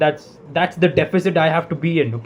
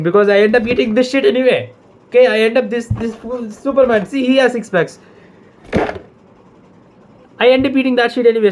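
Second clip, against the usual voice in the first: exasperated rant; 7 semitones higher, faster than usual. Real, not acted. Ranting about Diet Coke.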